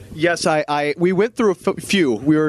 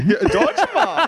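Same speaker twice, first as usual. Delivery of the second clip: put-on slow, raised comic German accent; mock German; 8 semitones higher, slower than usual. Host trying out a German stage name, mock German accent. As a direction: draw out the German name playfully. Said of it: "doing the entire show in German".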